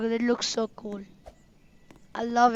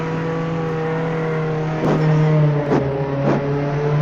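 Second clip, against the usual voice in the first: low, loud burst of laughter; 9 semitones lower, 5 dB louder than usual. Real, not acted. Kid playing a game, laughing loudly.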